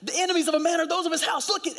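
high-pitched, fervent proclamation; excited proclaiming. Retold or imitated. Husband showing his crying wife scripture at the airport, excited. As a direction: exclaim it high, like a thrilling discovery.

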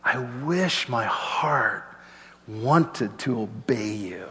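slow, soft plea to the Lord; longing prayer. Acted. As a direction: pray it quietly, wishing hard. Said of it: "here he's going".

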